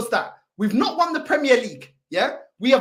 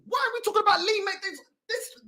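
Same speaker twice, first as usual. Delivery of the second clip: usual speaking voice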